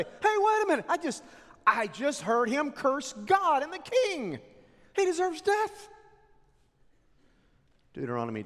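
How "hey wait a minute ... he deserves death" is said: much higher, loud accusing cry; false accusers. Acted. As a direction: cry out the lie before the crowd. False witnesses accusing Naboth.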